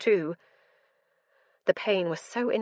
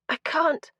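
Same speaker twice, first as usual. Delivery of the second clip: choked out; 8 semitones higher than usual. A narrator's reading. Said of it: "I choked out".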